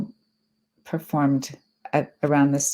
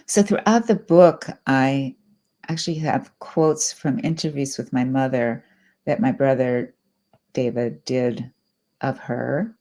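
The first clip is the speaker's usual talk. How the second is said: ordinary talk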